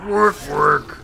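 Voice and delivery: loud orc peon grunt. Pretend. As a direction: bark it like a Warcraft peon. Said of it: "Like my orc voice?".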